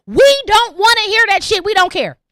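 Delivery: loud fed-up rant; blunt outburst. Real, not acted. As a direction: snap it at them. Ranting at oversharing listeners.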